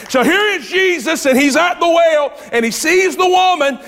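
high, loud preacher's shout; preaching at full force. Acted. Preacher telling the well story, shouting with fervour. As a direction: lift the congregation with a rising shout.

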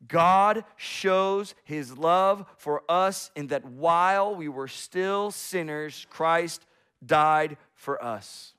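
slow, weighty scripture reading; slow emphatic reading. Shown as a demonstration. Pastor slowing down so the congregation hears the key verse. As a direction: lean on every word, slowly. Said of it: "listen to this part".

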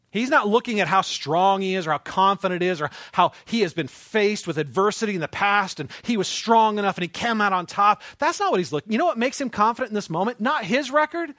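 rising sermon intensity; building preaching. Acted. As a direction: build the fervor to a peak.

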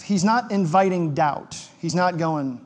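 usual speaking voice

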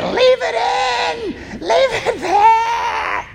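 put-on high, slow wailing child's plea; screaming child. Preacher imitating a child during sliver removal, high slow wail. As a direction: wail like a kid begging to stop. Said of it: "they're yelling things like".

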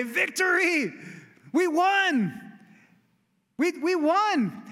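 high, excited victory cry; jubilant messenger. Retold or imitated. Runner calls out the victory to home, high and jubilant. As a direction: shout the win like a runner arriving breathless with joy.

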